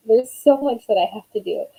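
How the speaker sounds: ordinary talk, nothing marked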